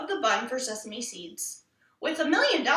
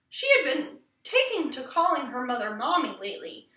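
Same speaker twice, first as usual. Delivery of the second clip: usual speaking voice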